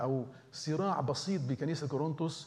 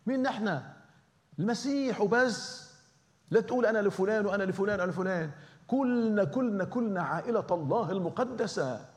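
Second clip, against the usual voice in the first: high, loud preaching call; full-force preaching; 7 semitones higher, 4 dB louder than usual. Acted. Preacher rousing the congregation about unity, full-force preaching. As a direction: preach it out to the whole church.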